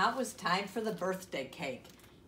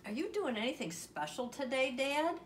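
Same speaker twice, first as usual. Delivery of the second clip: higher, hopeful little question to Dad; Froggy asking; 8 semitones higher than usual. A narrator's reading. Froggy asks Dad about today, higher-pitched question. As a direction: ask Dad lightly, pitched up. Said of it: "asked Froggy".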